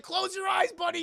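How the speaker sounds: high, loud instruction; acted frantic call; urgent shout. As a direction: yell advice to someone in danger. Crew calling to Justin.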